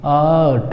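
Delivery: आर्ट with long aa and no r; teacher modelling आर्ट; silent r. Shown as a demonstration. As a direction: say आर्ट as "aat", dropping the r. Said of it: "तो पढ़ेंगे".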